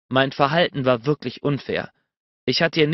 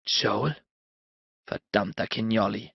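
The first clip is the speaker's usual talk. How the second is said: low, quieter jealous grumble under the breath; muttered curse; 3 semitones lower, 6 dB quieter than usual. A narrator's reading. Jealous Bennett curses his rival, low and quiet. Narrator's jealous inner thought.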